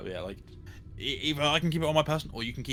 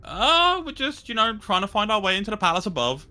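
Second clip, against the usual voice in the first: high friendly voice; 9 semitones higher, 5 dB louder than usual. Acted. Warren answers rat.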